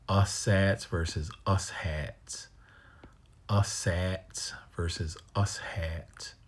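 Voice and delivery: us sats then us hats, slowly; slow contrast. Shown as a demonstration. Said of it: "Can you hear the difference?".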